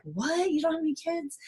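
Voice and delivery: surprised. Retold or imitated. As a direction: imitate people gasping at her. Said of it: "People are like".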